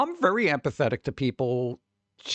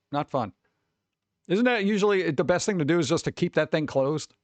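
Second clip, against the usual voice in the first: ordinary delivery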